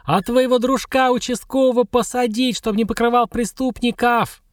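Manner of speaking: мерзко вопит, высоко и громко; визжи истошно, брызгая слюной; истошный вопль. Acted. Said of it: "снова завопила, истошно, мерзко". (истошно вопит на ведьму у калитки)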